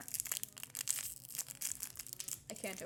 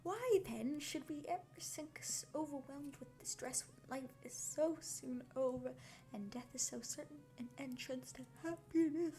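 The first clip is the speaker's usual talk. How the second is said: story reading; 4 semitones higher than usual. Acted. Reading novel aloud on stream.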